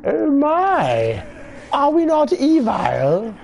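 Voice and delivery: high, slow hammed-up villain voice; high, theatrical mock-evil voice. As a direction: ham up the gleeful villain.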